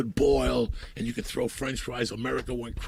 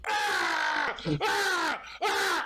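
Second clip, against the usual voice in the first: three wild howls, high and strained; mock anguished screams; 3 dB quieter, much slower than usual. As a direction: let it out in raw yelps. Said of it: "I could either go up there and go".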